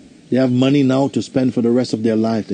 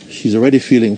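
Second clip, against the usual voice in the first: ordinary talk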